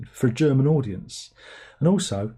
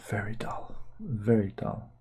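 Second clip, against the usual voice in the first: whispered aside; 7 dB quieter, slower than usual. Real, not acted.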